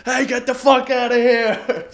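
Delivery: loud high yell; shouting. Retold or imitated. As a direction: bellow it at the crowd. Yelling at bats in cave.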